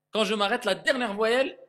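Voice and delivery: ordinary talk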